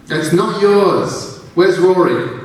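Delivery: high, loud, slow demand; angry. A narrator's reading. Amy angrily confronting the alien voice about Rory. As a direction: demand it back, furious.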